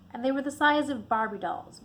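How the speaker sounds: plain reading voice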